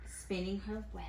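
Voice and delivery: ordinary talk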